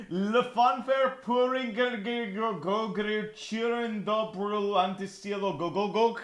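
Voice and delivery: drawn-out, tongue-tangled foreign syllables; garbled foreign name. Real, not acted. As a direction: blunder through an unreadable long name. Garbles a long foreign place name.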